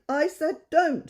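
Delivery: slow, high, loud royal command; grandest voice. Acted. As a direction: draw it out like a king giving orders. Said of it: "in his grandest voice".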